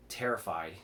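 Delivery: ordinary delivery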